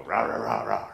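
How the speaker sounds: imitated old dog growling; grouchy dog growl. Old dog disturbed when you move wrong, mock growling. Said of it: "like a old dog".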